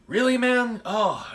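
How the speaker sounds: high, loud, mock-amazed "really, man?"; mock surprise. Friend mock-amazed at the coffee shop, loud and high. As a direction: play up the fake astonishment, high and loud.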